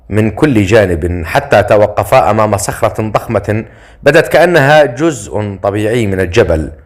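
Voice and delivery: ordinary delivery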